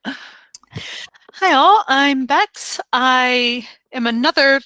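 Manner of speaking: usual speaking voice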